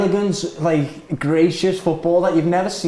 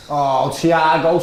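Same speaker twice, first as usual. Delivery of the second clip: ordinary delivery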